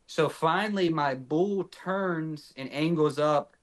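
ordinary talk

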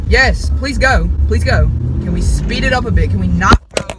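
loud, impatient pleading at traffic; road rage. Real, not acted. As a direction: hurry them along, exasperated. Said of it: "my road rage is kicking in".

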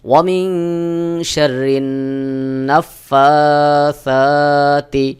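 very slow chanted recitation with ghunnah; slow Quran recitation. Shown as a demonstration. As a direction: draw out each word in tajweed. Recites the verse. (ধীরে তাজবীদসহ তিলাওয়াত)

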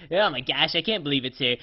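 ordinary delivery

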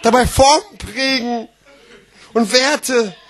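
high, emphatic, exclaimed phrases; grand slam declaiming. Acted. Slam poet exalting self-expression, grandly exclaimed. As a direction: proclaim each phrase like a great triumph.

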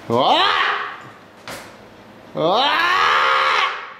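high, hammed-up yelp; mock yelp. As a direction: let out a silly startled yell. Sudden mock yelps.

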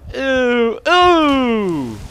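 high, loud disgusted yelps. Real, not acted. Player seeing something gross on screen, squealing.

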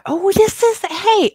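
a startled, high-pitched oh hey; sudden realization. Real, not acted. Suddenly remembers to start episode.

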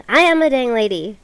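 AM stressed loud and high; emphatic shout. Real, not acted. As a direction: protest loudly, hitting am. Repeats lady louder.

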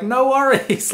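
high, chirpy mock-innocent reply; naively cheerful. Clueless ninja answering a rude driver, naively cheerful and high. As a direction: answer brightly, missing the insult entirely.

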